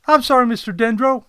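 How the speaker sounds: high, loud, quick apology; assistant Kodet. Acted. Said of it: "Kodet replied".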